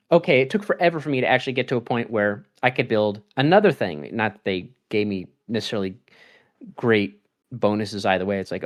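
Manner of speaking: normal talking voice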